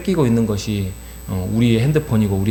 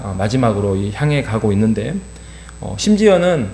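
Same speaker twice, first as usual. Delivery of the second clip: ordinary talk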